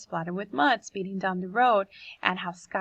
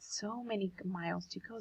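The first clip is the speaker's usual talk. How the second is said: quiet quote; 13 dB quieter than usual. Shown as a demonstration. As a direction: say it softly, letting it sink in. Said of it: "That's why he said that".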